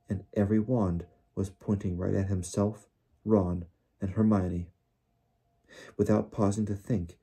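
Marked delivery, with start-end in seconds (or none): none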